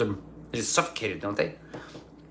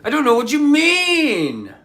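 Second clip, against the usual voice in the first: high, loud put-on voice; a hammed-up higher, louder retort; mock outburst; 13 semitones higher, 6 dB louder than usual. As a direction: squeal it back at her in a higher, louder voice. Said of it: "And I was like".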